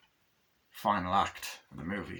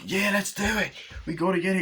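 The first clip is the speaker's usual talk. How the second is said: high, eager mock cheer; hyped-up; 10 semitones higher, faster than usual. Bad guy at the beach urging the others on, hyped-up. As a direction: egg them on in a high, hyped voice.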